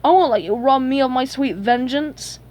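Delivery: indignant protest. Acted. Plankton robbed of his long-awaited vengeance, indignant protest. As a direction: object urgently, rushing the middle.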